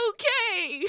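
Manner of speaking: high, wavering host voice; a host thrown off by a crying kid; high startled okay; Lila, faltering. Acted. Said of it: "Lila's like".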